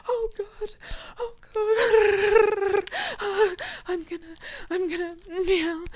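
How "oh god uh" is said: "Oh, God" moaned much louder. Acted. Catgirl stuck in a window, moaning loud at her partner. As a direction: let it out loud.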